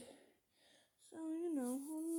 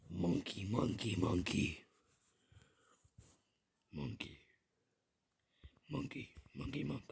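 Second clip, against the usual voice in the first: silly monkey voice; 3 dB louder than usual. Pretend. Silly chant of 'monkey'.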